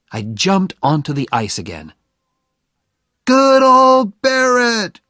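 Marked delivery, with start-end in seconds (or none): 3.2-5.0 high, loud, drawn-out cheer; crowd shout. A narrator's reading. Hockey crowd cheers Barrett back onto the ice, shouting. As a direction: cheer it out slowly at full volume. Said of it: "shouted the crowd".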